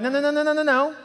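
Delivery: high-pitched string of noes; head someone off in a high voice; playful refusal. Acted. God stopping a hurried believer, high playful refusal.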